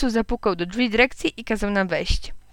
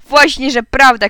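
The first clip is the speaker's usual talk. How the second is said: loud, higher-pitched schoolboy contradiction; shouted retort; 4 semitones higher, 10 dB louder than usual. A narrator's reading. Schoolboy contradicting his rival before the headmaster, shouted retort. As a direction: shout it back at your rival. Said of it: "krzyknął Eusebiusz".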